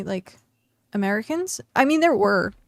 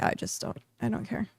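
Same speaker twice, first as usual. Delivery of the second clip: soft, half-whispered shrug; flat dismissal; 8 dB quieter than usual. Retold or imitated. Answering the France fans, softly dismissive. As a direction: brush it off under your breath. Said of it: "And I'm like".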